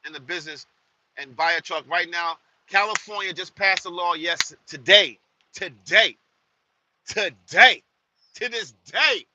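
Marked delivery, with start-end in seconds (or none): 4.1-9.2 louder each time, rising to a shout on the last today; loud insistence. Real, not acted. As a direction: hammer the word today harder and harder. Insisting the law passed today.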